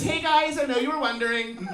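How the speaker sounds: high put-on vlogger voice; mocking high-pitched vlog intro; mock influencer. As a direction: imitate a self-important influencer, loud and high. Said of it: "they all think they're famous".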